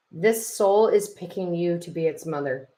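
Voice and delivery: ordinary talk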